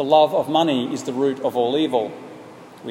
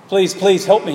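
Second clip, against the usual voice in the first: higher and louder, mildly acted; yelling plea; 6 semitones higher, 5 dB louder than usual. A narrator's reading. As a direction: beg hard, calling through the glass. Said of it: "he yelled".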